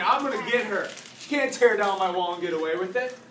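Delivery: loud, indignant vow; determined anger. Acted. Emperor on a mission after his wall fell, angry. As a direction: declare it forcefully, out to get her. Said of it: "he was on a mission".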